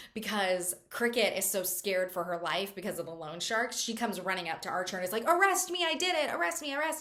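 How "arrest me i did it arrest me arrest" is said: high, urgent pleading; frantic begging. Retold or imitated. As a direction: beg him desperately. Terrified girl begging for arrest.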